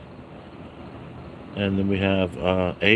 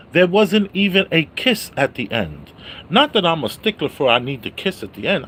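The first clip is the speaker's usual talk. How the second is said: normal talking voice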